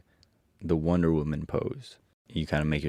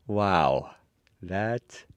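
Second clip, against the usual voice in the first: plain reading voice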